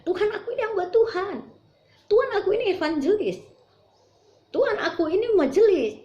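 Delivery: high, protesting aku ini hamba Tuhan; indignant pleading. Acted. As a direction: insist on your own rank before the Lord. Quoting servants boasting to Lord. (protes memelas dengan nada tinggi)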